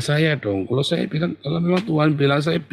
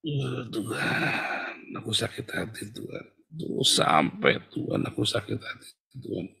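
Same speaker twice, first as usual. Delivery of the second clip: hurt prayer; 2 semitones lower, 9 dB quieter than usual. Acted. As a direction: murmur your heartache to God. Acting a hurt believer praying. (doa lirih orang sakit hati)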